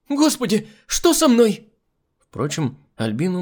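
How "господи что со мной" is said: loud, very high panicked cry; yelling in horror. A narrator's reading. Hostess facing the mirror, yelling in horror. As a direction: scream it at your reflection. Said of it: "и заорала".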